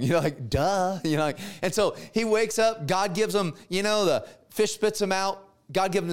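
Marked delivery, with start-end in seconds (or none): none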